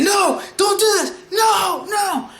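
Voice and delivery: high-pitched imitation of panicked shouting; losing it. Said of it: "Subaru's losing it".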